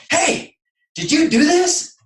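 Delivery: high-pitched, indignant challenge; retold confrontation. Confronts the driver in retold scene.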